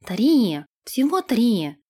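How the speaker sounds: mildly exclaimed correction; exclaiming. A narrator's reading. Nash hastily corrects Irga's confession, exclaiming. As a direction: protest with a small outcry. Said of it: "воскликнул Нэш".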